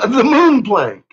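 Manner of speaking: normal talking voice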